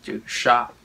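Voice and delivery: normal talking voice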